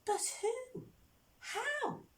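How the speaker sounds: high, baffled splutter. Acted. Shoemaker baffled by the finished shoes, spluttering. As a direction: splutter the questions in high-pitched disbelief. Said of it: "spluttered the shoemaker".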